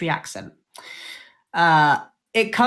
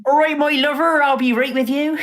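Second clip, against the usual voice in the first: West Country accent; 5 semitones higher than usual. Shown as a demonstration. Showing friends how West Country folk say hello, West Country accent. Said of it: "how we say hello here".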